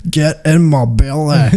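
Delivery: comic Scottish glutton; Fat Bastard. Acted. Friend quoting Austin Powers, hammed-up Scottish accent. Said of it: "used to watch Austin Powers".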